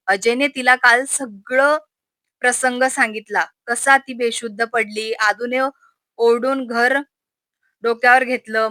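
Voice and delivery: plain reading voice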